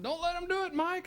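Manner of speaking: high, panicked hollering; mock holler. Friend hollers to Mike while separated, high panicked. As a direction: yell it up high like being dragged off. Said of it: "And Aaron's hollering".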